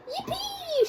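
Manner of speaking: high squeal of joy; gleeful yippee. Acted. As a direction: squeal it with glee. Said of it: "shouted George".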